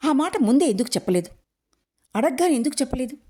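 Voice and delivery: a rapid, exasperated telling-off; father scolding his daughter; angry scolding. A narrator's reading.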